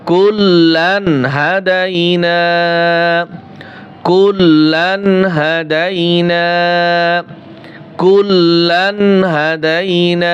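kullan hadaina drawn out in measured recitation; slow recitation. Shown as a demonstration. Teacher recites the joined phrase slowly for students. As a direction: recite each syllable slowly and evenly. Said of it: "repeated slow recitation demo".

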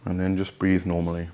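soft, unhurried voice with long pauses; guided meditation. Real, not acted. Host guiding listeners to let each body part relax, soft meditation voice. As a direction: ease them into relaxation, softly, phrase by phrase.

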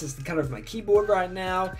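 ordinary delivery

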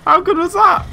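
high, loud crowing; gleeful bragging. Real, not acted. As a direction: show off in a triumphant squeal. Boasts after a game success.

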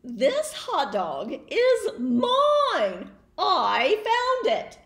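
slow, loud, laughing claim; possessive pigeon. Acted. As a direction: insist it's all yours. Pigeon claims his find.

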